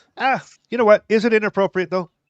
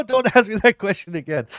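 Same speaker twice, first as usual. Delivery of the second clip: high and loud, laughing through the words; laughing mock plea; 8 semitones higher, 6 dB louder than usual. Joking about crying at work, laughing plea. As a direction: giggle out the protest. Mock answer to boss's question.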